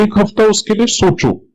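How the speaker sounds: normal talking voice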